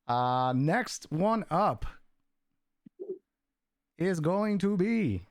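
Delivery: slow, stretched-out build-up; suspenseful announcement. Real, not acted. Host announces the next giveaway winner, drawn-out suspense. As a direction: draw each word out to hold the suspense.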